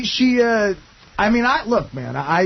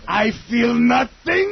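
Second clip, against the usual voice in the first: Schultz impression; 6 semitones higher, much slower than usual. Telling friends how she sat there, mock German shout. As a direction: bark it like a cartoon German guard. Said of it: "like Schultz in Hogan's Heroes".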